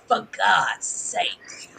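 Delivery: breathed out slowly; whispered exasperation. Real, not acted. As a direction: sigh the words under your breath. Exasperated aside.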